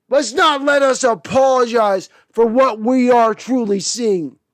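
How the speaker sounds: normal talking voice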